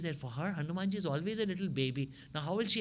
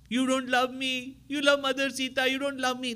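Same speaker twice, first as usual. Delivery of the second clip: put-on weeping child; 10 semitones higher, 5 dB louder than usual. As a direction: sulk and wail like a hurt little boy. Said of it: "Hanumanji starts weeping".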